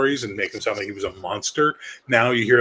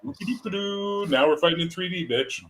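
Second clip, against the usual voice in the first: mocking Kirk voice; 7 semitones higher than usual. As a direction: taunt like a smug captain. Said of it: "Kirk's like".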